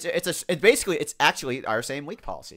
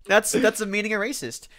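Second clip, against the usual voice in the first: ordinary talk, nothing marked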